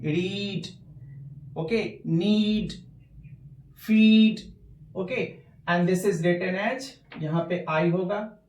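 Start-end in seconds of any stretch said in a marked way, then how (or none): 0.0-4.4 रीड, नीड, फीड with the ee sound held long; long vowel shown. Shown as a demonstration. Teacher holding the long vowel in each word. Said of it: "वो लंबा खींचता है tone".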